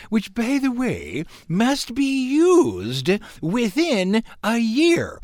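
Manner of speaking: slow, high put-on British accent. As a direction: read the hotel's terms like a prim Brit. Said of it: "I should do this in a British accent".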